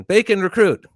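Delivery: high, loud chow-line bark; recruit's shouted call. Retold or imitated. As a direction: sound off the order like a recruit.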